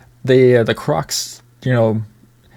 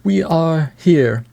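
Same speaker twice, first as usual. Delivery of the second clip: slow, raised mock British accent; 5 semitones higher, slower than usual. Imitating the monkey man's lines in a mock British accent. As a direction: put on a posh British accent, drawn out. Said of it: "in a British accent".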